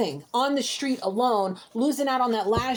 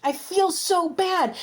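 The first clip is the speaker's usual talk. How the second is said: close to tears; 6 semitones higher, slower than usual. Real, not acted. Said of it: "I really wanna start crying".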